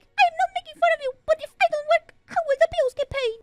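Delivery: high, quick teasing mimicry; mocking dad. Dad teasing his daughter about paying bills, high fast mimicry. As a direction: keep parroting her, fast and high.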